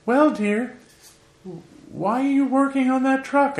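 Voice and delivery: high, put-on voice of a pampered aunt; imitating rich aunt. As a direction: squeak it out like a fussy rich auntie. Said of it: "she came out and she said".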